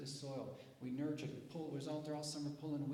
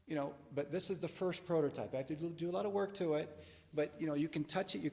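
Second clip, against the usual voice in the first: louder, eager, talking it up; enthusiastic; 4 semitones higher, 7 dB louder than usual. Shown as a demonstration. Inventor pitching his first prototype to the room, loud and eager. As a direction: pump up the prototype. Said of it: "Now I say".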